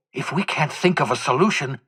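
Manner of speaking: normal talking voice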